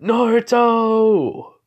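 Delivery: high, loud, long-held cry; anguished shout after someone lost; desperate scream. A narrator's reading. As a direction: scream the name, stretching it out. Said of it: "Kakashi screams out".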